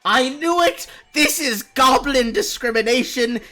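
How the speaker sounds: high, loud triumphant goblin yell; outraged goblin. Acted. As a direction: cry discrimination at the guards. Goblin outburst at guards.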